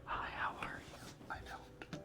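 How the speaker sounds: usual speaking voice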